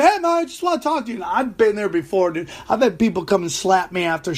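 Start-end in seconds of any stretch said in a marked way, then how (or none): 0.0-1.2 imitating the little guy.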